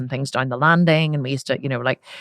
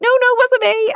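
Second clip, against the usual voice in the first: put-on very high, louder sing-song child voice; child's denial; 6 dB louder than usual. Girl lying to dad in a very high child voice. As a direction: squeak the lie like a guilty kid. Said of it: "And I remember being like".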